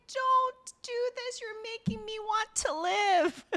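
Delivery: high, slow mock-sobbing plea; retold sobbing. Sobbing woman pleading with him, high hammed-up imitation. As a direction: wail it slowly in a high imitation. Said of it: "She's sobbing".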